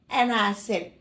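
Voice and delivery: usual speaking voice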